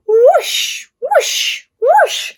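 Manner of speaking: rising whoosh sounds. Acted. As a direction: swoop the voice up like a ride. Carousel spinning sound.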